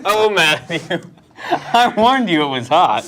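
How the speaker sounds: laughing. Real, not acted. Laughing told-you-so.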